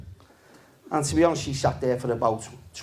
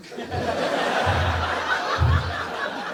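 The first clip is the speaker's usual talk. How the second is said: breathy laugh; 10 semitones higher than usual. Real, not acted. Laughs at own joke.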